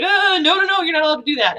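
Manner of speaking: high, loud, fast panicked protest; panicked inner voice. Retold or imitated. Stopping herself from crying, panicked high protest. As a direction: squeal a frantic no like a scolding inner alarm.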